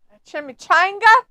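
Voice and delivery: high, loud incredulous chimichanga; mocking question. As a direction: scoff at the word. Mocking the order.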